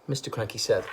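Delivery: ordinary delivery, nothing marked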